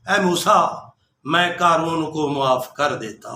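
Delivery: hushed, gentle telling of mercy. Acted. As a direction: speak it low and kindly, like a secret of mercy. God tenderly tells Moses.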